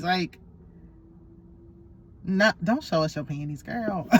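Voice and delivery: ordinary talk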